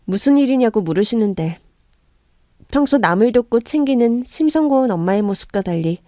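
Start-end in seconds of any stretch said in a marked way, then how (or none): none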